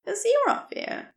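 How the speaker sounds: gentle rescuer voice. Acted. Narrator voices Aziraphale.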